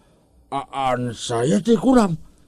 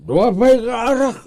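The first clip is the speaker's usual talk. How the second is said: eager call for more; 10 semitones higher, 5 dB louder than usual. Acted. Calling for more drink.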